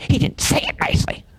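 unvoiced mocking whisper; hushed whiny whisper; whispered complaint. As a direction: whisper the grievance like gossip.

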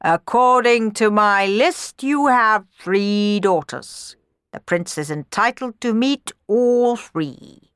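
high, slow, deliberate announcement; official pronouncement. A narrator's reading. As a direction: read it out like a stately official. Official challenge from his list.

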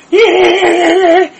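piercing, very high, very loud squeal like a pig; dad's laugh mocked as a wild squeal; mocking squeal laugh. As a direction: screech it like a pig being run over. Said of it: "makes the same fucking noise".

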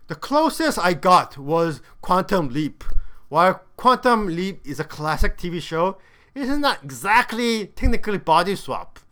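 normal talking voice

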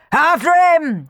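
high, loud chase cry; shouting. Acted. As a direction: bellow after the runaway. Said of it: "shouted Bob and Clem".

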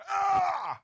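high mock angry yell; angry shout. Friend yelling during the bickering argument, mock angry shout. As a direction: bark it out in anger. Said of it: "really angrily".

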